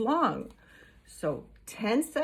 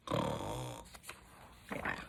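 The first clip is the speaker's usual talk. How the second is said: snore; 12 dB quieter than usual. Acted. Imitating a snore.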